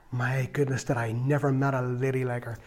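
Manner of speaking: hushed, breathless whisper of awe; awed whisper. Real, not acted. Remembering praying Martha, whispering in awe. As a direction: breathe it out in wonder.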